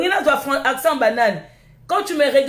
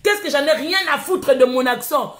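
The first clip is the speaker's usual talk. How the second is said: usual speaking voice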